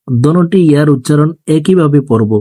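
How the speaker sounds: ordinary talk, nothing marked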